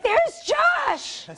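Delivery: high-pitched excited mock scream; retold screaming. Host imitating herself yelling at the TV, excited scream. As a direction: shriek it like a fan spotting a friend on screen. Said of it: "and I started screaming".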